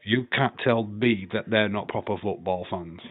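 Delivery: usual speaking voice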